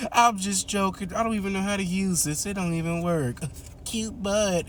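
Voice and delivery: lightly voiced, with a laugh; mock playful laugh-off. Ma drops the joke on the naked boy, giggling.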